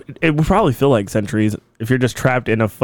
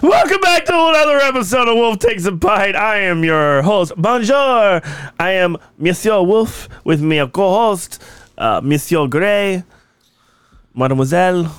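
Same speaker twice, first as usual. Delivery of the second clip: mock French accent; 5 semitones higher than usual. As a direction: play a cheesy Frenchman hosting the show. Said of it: "Little, little bit not French".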